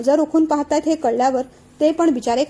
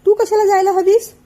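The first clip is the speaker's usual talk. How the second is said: exasperated husband; 7 semitones higher, 6 dB louder than usual. Acted. Husband arguing with crying wife.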